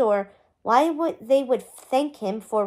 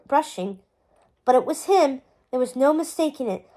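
ordinary delivery, nothing marked

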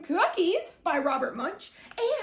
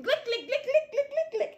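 higher, bouncy glick with a laugh; playful glick sounds; 6 semitones higher than usual. A narrator's reading. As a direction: smear each glick playfully. Spreading icing.

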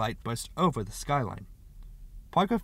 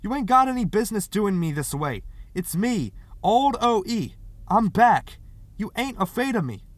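high, loud pleading; urgent, raised-voice appeal; 9 semitones higher, 6 dB louder than usual. A narrator's reading. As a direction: plead through the door to be recognised. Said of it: "Parker pleaded".

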